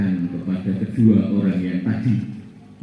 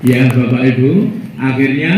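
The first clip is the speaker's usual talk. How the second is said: ordinary talk, nothing marked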